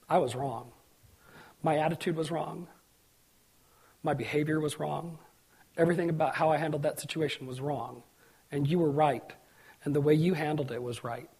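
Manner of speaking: low, soft, contrite admission; subdued retold confession; humble apology. Retold apology from estranged friend.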